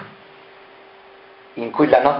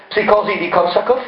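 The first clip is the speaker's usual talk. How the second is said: usual speaking voice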